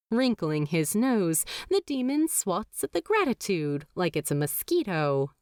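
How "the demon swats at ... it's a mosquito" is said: ordinary delivery, nothing marked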